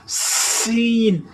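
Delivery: سین with a long hissing s; sharp s sound. Shown as a demonstration. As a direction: stretch the s of سین into a hiss. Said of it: "سین کی سی ڈی کی آواز آپ نے نکالنی". (سین میں سی کی لمبی آواز)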